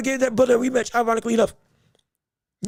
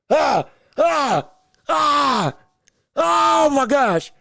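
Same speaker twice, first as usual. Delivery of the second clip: anguished frustration; 3 semitones higher, much slower than usual. Real, not acted. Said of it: "Let me calm down".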